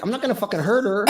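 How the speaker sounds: high, fast retold retort; exasperated protest. Performer answering the girlfriend, exasperated protest. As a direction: act out indignant reassurance in a rush.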